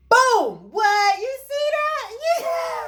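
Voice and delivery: very high, squealed celebration; playful hype. Pretend. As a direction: hype up the big reveal in a squeal. Celebrates revealing the bump, dancing.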